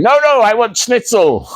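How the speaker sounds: high, pleading demand for a treat; hammed-up childish plea; mock childish insistence. To publishers offering exotic food, high childish plea. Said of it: "And I'm going".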